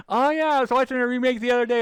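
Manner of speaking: high, loud, fast mock chatter. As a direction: rattle it off as a breezy know-it-all. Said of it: "is like".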